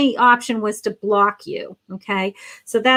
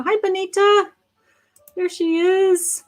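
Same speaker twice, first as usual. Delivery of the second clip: bright high greeting; 8 semitones higher than usual. Real, not acted. Greets viewer warmly.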